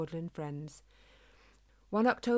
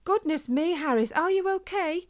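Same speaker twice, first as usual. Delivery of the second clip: loud, much higher worried call; 10 semitones higher, 7 dB louder than usual. A narrator's reading. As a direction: call across to a hurt friend. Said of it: "calling to him".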